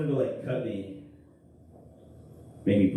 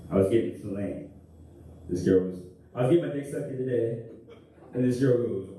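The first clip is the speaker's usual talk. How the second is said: ordinary delivery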